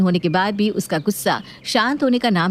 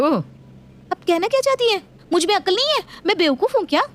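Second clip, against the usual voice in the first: offended; 11 semitones higher than usual. Acted. Daughter-in-law offended by mother-in-law's jibe, higher. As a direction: take real offence.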